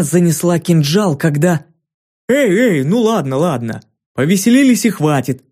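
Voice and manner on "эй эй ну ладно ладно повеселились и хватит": higher, hastily backing down, a mild grumble; grumbling fury. A narrator's reading. Giantess calling off the fight with Ki, higher grumbling back-down. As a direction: call it off with a half-laughing grumble. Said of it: "буркнула Фурия".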